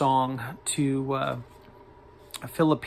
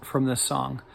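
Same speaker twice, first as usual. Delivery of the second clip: ordinary delivery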